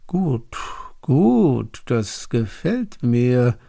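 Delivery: flattered king, drawn-out approval. A narrator's reading. King pleased by his princes' proposal, flattered. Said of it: "fühlte sich der König geschmeichelt".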